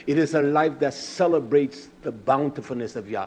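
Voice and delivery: ordinary talk, nothing marked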